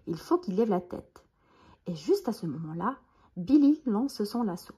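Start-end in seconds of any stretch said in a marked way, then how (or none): none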